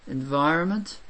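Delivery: usual speaking voice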